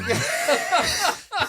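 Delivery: high laugh. Real, not acted. Laughs at joke.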